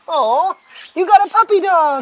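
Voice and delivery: loud, high-pitched gushing; big high "aw" to a pet; excited pet talk. Real, not acted. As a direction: fuss delightedly over the dog's new toy. Gushing over dog's new toy.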